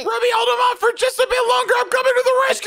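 high shout across the map; rally your teammate with a loud high call. Acted. Player shouting to an ally that help is on the way, loud high call. Calling to teammate mid-fight.

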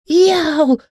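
high, loud yelp of pain; squeal. A narrator's reading. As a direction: squeal as if pecked. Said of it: "squealed Ferdinand".

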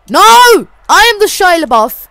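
high, loud bombastic proclamation; hyped-up mock-grand yelling; shouted declaration. Declaring his made-up title loudly.

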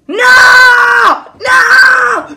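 high, loud cry of grief; anguished scream. Acted. Mother realises it is her daughter, anguished scream. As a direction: wail it at full force.

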